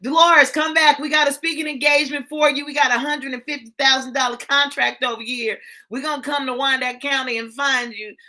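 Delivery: loud, high, eager calling out; eager callers. Pretend. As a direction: chase her down with big offers. Imagined callers chasing her.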